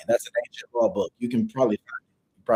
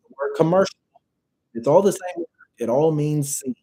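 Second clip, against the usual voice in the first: usual speaking voice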